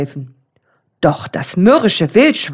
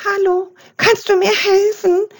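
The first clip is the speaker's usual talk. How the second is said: much higher, tearful frightened plea; frightened whimper; 11 semitones higher than usual. A narrator's reading. The trapped raccoon begs the raven for help, frightened and tearful. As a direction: whimper for help from inside the bin. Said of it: "wisperte es verängstigt".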